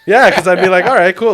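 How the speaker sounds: laughing loudly while telling it. Real, not acted. Laughing loudly to his friend about the story. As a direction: laugh through the words.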